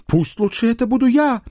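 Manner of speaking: very high, loud self-sacrifice; melodramatic wail. Acted. Page offers himself for the beating, wailing. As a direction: cry out heroically, pitched right up.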